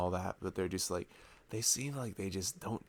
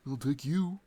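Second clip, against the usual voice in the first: high, put-on voice of a shallow guy; mock shallow guy; 9 semitones higher, 4 dB louder than usual. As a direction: toss it off like a shallow player.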